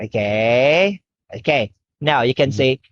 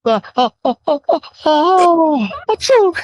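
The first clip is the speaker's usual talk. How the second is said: fake sneeze; 12 semitones higher than usual. Teacher fakes a sneeze.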